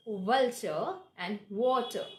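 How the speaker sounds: slow vulture and water, v bitten, w rounded; careful stressed minimal contrast; V versus W. Shown as a demonstration. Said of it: "So this is the difference".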